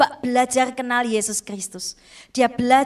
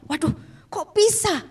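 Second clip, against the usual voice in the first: high-pitched retold disbelief; astonished father; 7 semitones higher than usual. Father hearing the test result, acted astonishment. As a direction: imitate a papa caught by amazing news.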